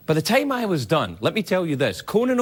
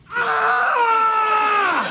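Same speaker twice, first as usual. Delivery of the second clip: startling scream. Acted. Surprise element of surrealism.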